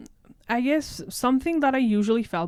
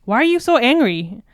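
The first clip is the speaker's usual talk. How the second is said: loud, higher exasperated parent; louder, higher mock scolding; imitating annoyed parents; 4 semitones higher, 8 dB louder than usual. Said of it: "They will automatically associate that with the word angry".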